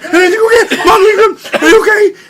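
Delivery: high, loud calling to mom; half-laughing mock distress; frantic worried shout. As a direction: yell in panic for her safety. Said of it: "I'm like".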